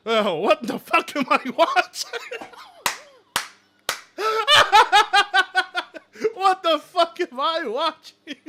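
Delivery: cracking up in shock; laughing disbelief. Real, not acted. Laughing at clip.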